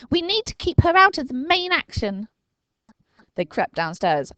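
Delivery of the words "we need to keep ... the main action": put-on high, louder boy's orders; brief the team urgently; Dinking instructing. A narrator's reading. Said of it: "he said".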